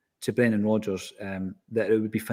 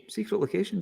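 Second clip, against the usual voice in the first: mock mysterious; 9 semitones higher, 5 dB quieter than usual. Real, not acted. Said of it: "repeated teasingly, higher".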